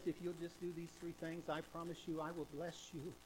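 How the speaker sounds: a hint of a soft divine voice; voice of God. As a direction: speak as God, gently promising. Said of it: "God says".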